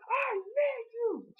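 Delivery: high, wailing, slowed sob-shout; Heyman's crying scream. Retold or imitated. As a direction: wail it like a heartbroken manager. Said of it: "He's like".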